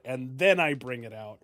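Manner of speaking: ordinary talk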